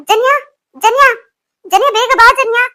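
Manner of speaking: high, loud yells for Janya across the house; urgent calling out. Acted. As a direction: shout for help, rising each time. Calling for help after collapse. (ಜೋರಾಗಿ ಸಹಾಯಕ್ಕೆ ಕೂಗು)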